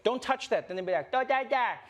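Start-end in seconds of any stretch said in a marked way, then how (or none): 0.0-0.7 lightly voiced parent, high; parent scolding. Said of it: "you'll be like". 1.1-1.7 put-on high toddler babble "Da, da, da"; small child copying the tune in nonsense syllables; child mimicking. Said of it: "they mimic everything you say".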